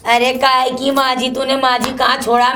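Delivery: ordinary talk, nothing marked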